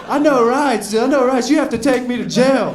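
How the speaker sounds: acted-out high-pitched shouting; belligerent drunk. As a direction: loudly demand it, drunk and combative. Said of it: "that's when I got belligerent".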